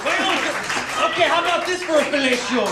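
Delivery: high-pitched exasperated father impression; ranting dad. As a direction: work yourself up as an indignant dad. Dad ranting about coffee prices.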